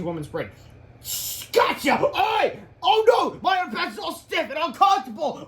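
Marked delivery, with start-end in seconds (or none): 1.5-5.4 very high, loud yelping; hammed-up shrieking complaint; cartoon distress. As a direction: wail as a hero under attack. Hero hit by starch, wails.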